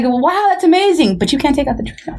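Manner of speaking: sarcastic praise. Retold or imitated. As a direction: gush falsely, then drop the punchline. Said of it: "And I'm like".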